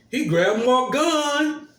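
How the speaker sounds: high, loud, slow mock Southern drawl; mocking Southern accent. Imitating the lying cop's Tennessee accent, slow and loud. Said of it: "with that ugly accent".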